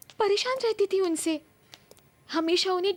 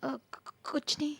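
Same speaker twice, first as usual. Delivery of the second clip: stammering, hushed denial; nervous, rattled; 3 semitones lower, 9 dB quieter, slower than usual. Acted. Rattled woman brushing off her fiancé's question, nervous and hushed. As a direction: falter softly, trying to hide the fright. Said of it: "तुम इतनी घबराई हुई क्यों लग रही हो".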